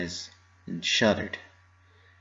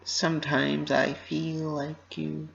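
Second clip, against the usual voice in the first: hesitant confiding; 7 semitones higher, 7 dB quieter, slower than usual. Acted. Pinky confiding, after deep breath.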